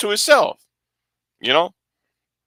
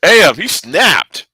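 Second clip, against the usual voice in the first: slow awed remark; much slower than usual. Real, not acted. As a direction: let each word hang in awe. Awed by Batman's violence.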